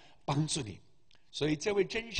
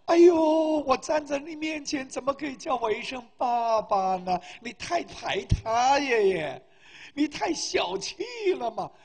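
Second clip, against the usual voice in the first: hammed-up wheedling reproach; mock complaint; 6 semitones higher, 6 dB louder than usual. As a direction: open on a loud yelp, then plead teasingly. Acted teasing complaint in the dialogue.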